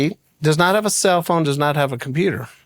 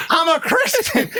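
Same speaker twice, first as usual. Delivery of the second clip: laughing protest; 12 semitones higher than usual. Retold or imitated. Indignant retort to mom.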